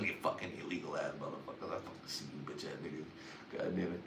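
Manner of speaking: low, hushed, quick grumble; muttered mock abuse. Ribbing big relatives under his breath, muttered low.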